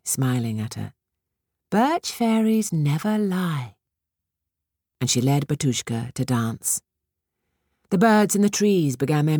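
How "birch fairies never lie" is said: high, slow and soothing fairy; tinkling fairy voice. A narrator's reading. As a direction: coax her to trust, slowly. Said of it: "said the birch fairy".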